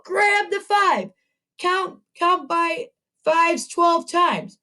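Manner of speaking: rally the class, voice lifted high; teacher pumping up second graders for a Yoda challenge; excited. Real, not acted.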